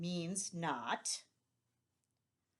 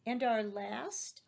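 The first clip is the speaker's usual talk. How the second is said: ordinary delivery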